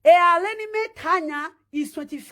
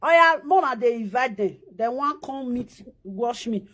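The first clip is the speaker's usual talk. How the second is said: ordinary talk, nothing marked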